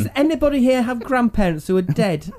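stage psychic. Pretend. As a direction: fish for a hit from the audience. Mock psychic fishing in audience.

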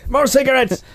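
high, loud, hammed-up ranting comic; mock rant. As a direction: shriek it like a comedian begging for poison. Continuing the Bill Hicks mockery.

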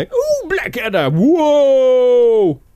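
high, loud, drawn-out posh squeal; hammed-up Blackadder squeal. Wishing Hugh Laurie sounded British again, a high drawn-out squeal. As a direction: squeal it like a foppish sitcom toff. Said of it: "I want him to go".

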